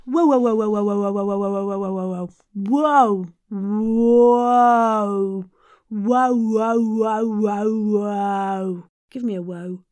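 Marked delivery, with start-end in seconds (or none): 0.1-2.3 loud run of whoas, dropping and fading; repeated whoas. Shown as a demonstration. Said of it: "Let's do some of that". 2.6-8.8 big, loud, swooping whoas; drawn-out whoas. Shown as a demonstration. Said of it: "Let's do some of that".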